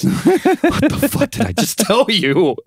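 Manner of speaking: squeaky incredulous lawyer impression; exasperated lawyer. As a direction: do the fed-up lawyer way up high. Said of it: "he's like".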